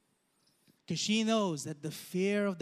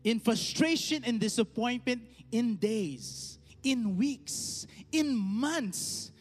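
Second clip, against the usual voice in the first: rising sermon delivery; 3 semitones higher than usual. Acted. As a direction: preach it with climbing pitch. Preaching the application.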